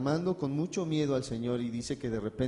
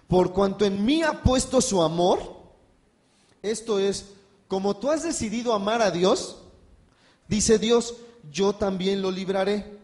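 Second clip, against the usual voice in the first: much louder, higher proclaiming of the verse; full-force preaching; 4 semitones higher, 6 dB louder than usual. Acted. Preacher proclaiming God's promise to the church, full force. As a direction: thunder the promise at the congregation.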